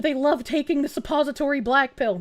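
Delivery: usual speaking voice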